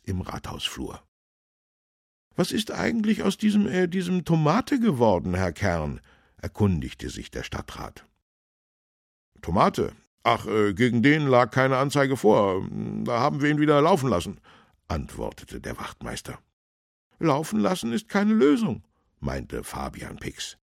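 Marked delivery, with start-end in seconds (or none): 2.3-6.0 high, loud, inquiring voice; councillor character. A narrator's reading. Councillor asks policeman after Tomate, high character voice. 9.4-14.4 higher, louder, offhand officer; policeman character. A narrator's reading. Policeman answers councillor about Tomate, higher and offhand. 17.2-18.8 high, loud, disapproving official; councillor character. A narrator's reading. Councillor corrects the policeman, high and firm.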